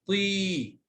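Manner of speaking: طي with a long, held "ee"; long vowel. Shown as a demonstration. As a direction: stretch the vowel in طي. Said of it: "مد بالياء".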